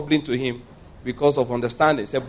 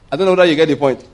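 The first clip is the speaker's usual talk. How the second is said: laughing; 5 dB louder, faster than usual. Real, not acted. Laughs while checking congregation follows.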